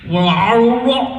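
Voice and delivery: slow, lightly voiced Joe drawl; a hint of Joe from Family Guy, unhurried; imitating Joe. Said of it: "And Joe's like".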